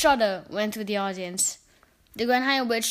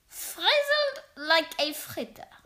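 higher, slow, gloating witch; Grand High Witch; 5 semitones higher, slower than usual. A narrator's reading. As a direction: savour each word slowly. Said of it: "said the Grand High Witch".

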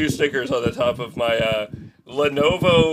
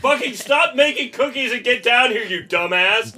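higher-pitched yell up the stairs; mock-annoyed shout up to a friend in the kitchen; calling upstairs; 8 semitones higher than usual. Real, not acted. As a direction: holler for him to come down. Yelling upstairs for Dan.

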